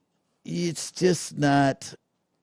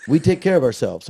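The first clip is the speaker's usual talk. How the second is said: retold store lady; 6 semitones higher, 3 dB louder, faster than usual. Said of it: "She goes".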